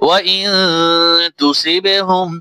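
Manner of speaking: slow, melodic tajweed recitation; Quran recitation. Acted. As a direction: recite the full verse with tune. Recites the verse in full.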